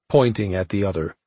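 plain reading voice

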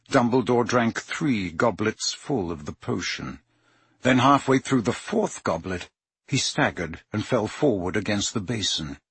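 usual speaking voice

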